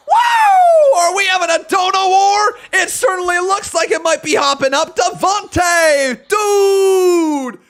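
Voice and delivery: high, loud, whooping hype-man shout; hyped-up shouting. Real, not acted. Streamer celebrating Devontae in a donation war, hyped shouting.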